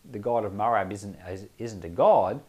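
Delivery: ordinary delivery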